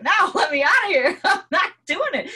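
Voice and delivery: high, loud, fast laughing no; laughing refusal. Retold or imitated. Refusing to repeat the cycle, laughing, high and loud. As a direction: laugh and refuse to go round again. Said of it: "I'm like".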